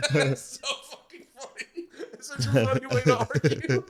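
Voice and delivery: words squeezed out through real laughter; cracking up. Real, not acted. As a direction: barely get it out for laughing. Cracking up at the joke.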